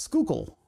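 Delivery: "Schuylkill" in two syllables with a hard sk; local pronunciation. Shown as a demonstration. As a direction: say "Schuylkill" as SKOO-kull. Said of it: "it's pronounced".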